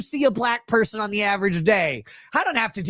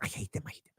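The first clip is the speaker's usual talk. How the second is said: whispered hate; 17 dB quieter, much faster than usual. Real, not acted. Repeated hate, now whispered.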